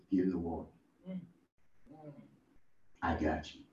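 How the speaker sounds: quietly hint at the reassuring daddy; calm father. Retold or imitated. Lightly voiced father telling his son, low and soft.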